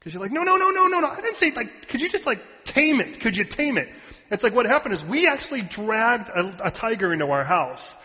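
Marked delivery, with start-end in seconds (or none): 0.3-3.9 high, frantic imitation of someone objecting; hammed-up panicked pleading; mock yelling protest. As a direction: burst out loud and high, then back down into bargaining. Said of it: "you wanna yell at God about it".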